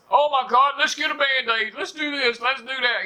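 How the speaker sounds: mock high, flustered fuss; panicky parent. Mocking today's parents panicking over a scrape, high and flustered. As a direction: flap about it up high, overdone alarm.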